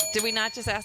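ordinary delivery

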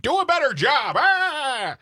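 high, loud cartoonish yell trailing into a scream; mock yelling. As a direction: yell it absurdly high, mocking an angry rant. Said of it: "He's yelling at Canada saying".

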